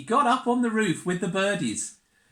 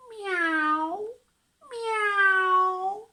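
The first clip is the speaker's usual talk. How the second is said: high rising meows; cat meow; 14 semitones higher, 3 dB louder than usual. Shown as a demonstration. Showing the child a cat noise, high meows. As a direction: meow like a kitten. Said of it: "what noise a cat makes".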